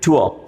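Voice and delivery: टू अ as "thoo-uh", no R; correct pronunciation. Shown as a demonstration. Teacher answering her own question, correctly pronounced. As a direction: glide टू into अ. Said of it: "क्या हो जाएगा ये?".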